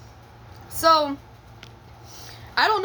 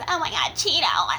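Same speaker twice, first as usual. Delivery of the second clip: mock dreamy sigh; 5 semitones higher than usual. Shown as a demonstration. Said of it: "wasn't the same thing as".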